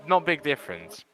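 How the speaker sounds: normal talking voice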